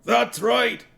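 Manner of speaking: high, loud dwarf shout; a dwarf shouting from the back, high and loud. A narrator's reading. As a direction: yell from the back of the crowd. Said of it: "shouted a dwarf".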